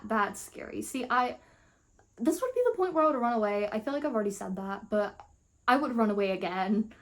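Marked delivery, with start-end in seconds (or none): none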